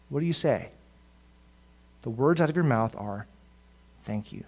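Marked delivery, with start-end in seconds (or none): 4.0-4.4 soft sincere thanks. Shown as a demonstration. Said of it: "With sincerity and, and depth".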